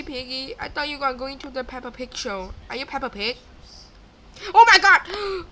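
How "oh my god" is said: high, loud burst of alarm; startled cry. Real, not acted. Player scared by Piggy, startled cry. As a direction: cry out in shock.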